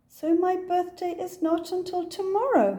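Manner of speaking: puzzled realisation. Acted. Puzzled Kipper works it out.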